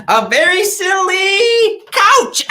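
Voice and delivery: high, stretched-out comic payoff; gleeful reveal. Pretend. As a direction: draw it out and break into laughter. Comic reveal of the song's noun.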